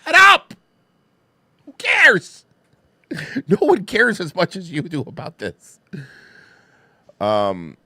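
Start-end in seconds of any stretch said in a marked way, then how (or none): 0.0-2.4 a flat groan that jumps to a high, loud mock yell. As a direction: ham up the yell at him. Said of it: "And I was like".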